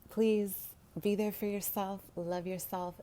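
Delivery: pleading with the audience to love themselves; earnest plea. Real, not acted. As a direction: call out to the viewers with feeling. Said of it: "closing plea to viewers".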